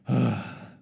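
a heavy, weary groaning sigh; demonstrated groan. Shown as a demonstration. Pastor acting out Jesus groaning for the church, heavy sigh. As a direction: let out a worn-down groan. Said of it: "He groaned".

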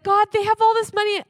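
loud, high desperate plea; retelling a pastor's anguished plea to God; crying out to God. Retold or imitated. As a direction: cry out to God over the mansions. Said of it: "And he's crying out".

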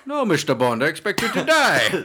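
loud, high hammed-up villain; over-the-top accented villain; mock Bond villain. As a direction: declaim it as a film villain. Movie villain line in accent game.

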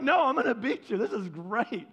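loud, high gloating boast; competitive taunt. Retold or imitated. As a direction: crow about winning, half playful. Competitive gloating in retold race.

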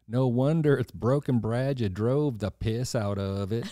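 a waitress in a mild East Tennessee drawl; sassy diner waitress, East Tennessee accent; lightly voiced East Tennessee waitress scolding Brad. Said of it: "Can you do that East Tennessee accent?".

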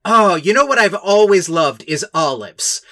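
hammed-up oblivious rich doctor; callous rich snob. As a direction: brush off a dying girl over olives. [name] callously dismissive, hammed.

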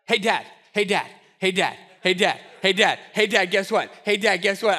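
put-on a light, pestering child voice, repeating without letup; nagging kid. Pastor imitating David as a nagging kid calling Dad, pestering. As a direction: tug at Dad's sleeve over and over like a nagging kid. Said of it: "he's like the nagging kid".

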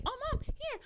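put-on high-pitched little boy calling Mom; imitated excited son. Son pestering mom to play, imitated high child voice. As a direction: pipe up like an eager small child. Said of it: "jumping and he's like".

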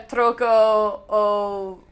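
trying it in Spanish; Spanish accent. Shown as a demonstration. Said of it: "I ca-, uh".

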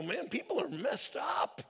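high exasperated mock complaint; disgusted, frustrated. Voicing a cynic giving up on people, exasperated. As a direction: act out being fed up with everyone. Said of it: "we start to get a little disgusted and frustrated".

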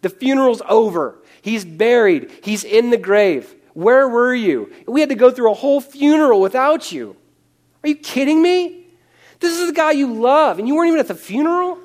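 rising outrage at an absent friend; angry grieving sister. Acted. Preacher voices Martha's angry complaint.

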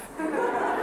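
high sudden laugh; burst of laughter. Real, not acted. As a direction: break into a high laugh at the absurdity. Laughing at own question.

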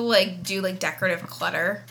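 usual speaking voice

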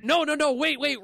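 usual speaking voice

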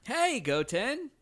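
high, drawn-out anime greeting; Gohan. Shown as a demonstration. Co-host's go-to impression, high stretched call. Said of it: "sounded like Gohan more than it sounded like Goten".

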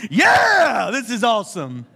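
high, loud whoop of delight; shouted child's cheer; kid's excited cheer. Retold or imitated. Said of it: "I was just like".

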